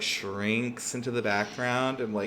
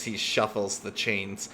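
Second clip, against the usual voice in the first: ordinary talk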